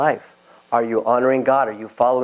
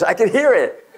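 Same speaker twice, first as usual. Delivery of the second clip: higher, laughing on the words; 8 semitones higher than usual. Real, not acted. Teller amused at the crowd, higher and laughing. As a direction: chuckle through it.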